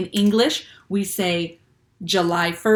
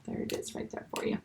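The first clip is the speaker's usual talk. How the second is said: whispered aside; 13 dB quieter, faster than usual. Real, not acted. Teacher aside while writing.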